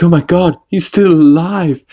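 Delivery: hammed-up shocked realization; acted astonishment. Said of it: "He's like".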